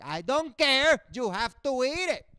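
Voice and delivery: high, loud put-on father; hammed-up stern father; mock insistent dad. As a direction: insist like a strict immigrant dad. Dad scolding kids at dinner.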